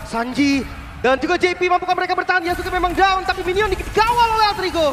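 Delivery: high, loud hype call; excited shouting caster. Real, not acted. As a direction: yell the play like a caster. Caster shouting after triple kill.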